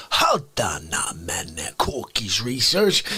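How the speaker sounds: low, slow mock outrage; hammed-up indignant catchphrase; How Dare You guy. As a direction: drop way down and drag it out. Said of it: "How Dare You guy".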